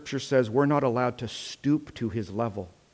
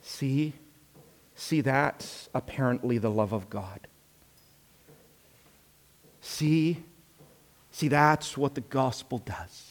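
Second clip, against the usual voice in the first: hushed, insinuating devil's aside; mock whispering; 6 dB quieter than usual. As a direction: drop soft, as if slyly whispering in an ear. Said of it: "so that I can whisper".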